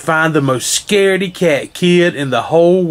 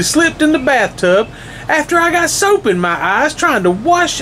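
high, fast, rising tearful rant, not plain tears; upset little boy lists his woes to the monster, rising and rushing; crying boy; 7 semitones higher, faster than usual. Acted. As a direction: pour it all out faster and higher as it piles up. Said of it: "the little boy cried".

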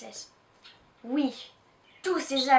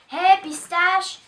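slow, mocking taunt, louder; sneering; 5 semitones higher, 9 dB louder, slower than usual. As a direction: drawl it out to tease her. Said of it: "ricane Madeleine".

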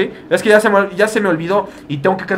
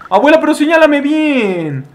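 high, drawn-out whine to grandma; exasperated plea; 9 semitones higher, 4 dB louder, slower than usual. Pretend. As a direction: plead with her, stretching it out slowly. Pleading with game grandma for directions. (súplica aguda y alargada)

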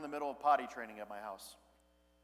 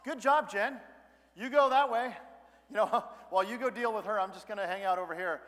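higher, louder joking dodge with a laugh; mock cop-out; 4 semitones higher, 5 dB louder than usual. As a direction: play it as a cheeky excuse to slip away. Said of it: "I go".

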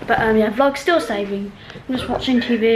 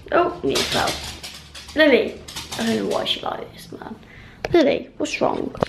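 normal talking voice

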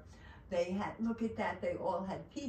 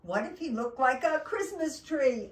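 rising, louder storybook excitement; higher, eager narration; excited idea; 5 semitones higher, 7 dB louder than usual. A narrator's reading. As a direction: build up to the big idea. Turkey has a great idea.

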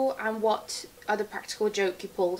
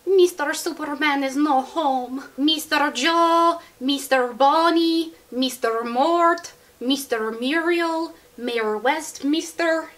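slow, heavily accented maid voice; Consuela impression; 4 semitones higher, 3 dB louder, slower than usual. Shown as a demonstration. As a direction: drawl each name out slowly. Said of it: "I can do Consuela from Family Guy".

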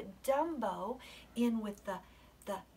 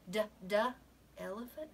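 ordinary delivery

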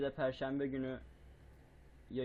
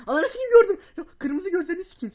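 shrieking, much higher and louder with a laugh; startled scream; 16 dB louder than usual. Real, not acted. Jumpscared while playing, screaming high and loud. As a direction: scream in real fright then laugh. Jump scare in horror game.

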